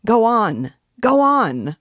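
high, loud shout; high, loud yell, pushing harder. A narrator's reading. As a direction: bellow it over the crowd's noise. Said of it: "shouted louder than before".

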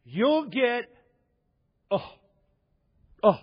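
high, halting mock revelation; imitated televangelist. Televangelist receiving a word with eyes closed, hammed-up halting. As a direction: act overcome, breaking off between words. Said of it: "just like this".

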